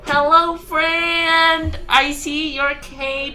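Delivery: high sing-song friendly voice; sweet high voice with a threat; Mister voice. Pretend. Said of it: "should I use my normal voice, my Mister voice".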